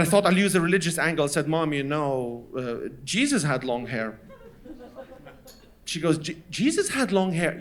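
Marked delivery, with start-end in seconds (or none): 1.5-4.2 low, soft, hesitant suggestion; quiet cautious argument; tentative plea. Retold or imitated. Child tentatively tries religious angle. 6.2-7.6 stammered disbelief from mother; imitated scandalized mother; incredulous echo.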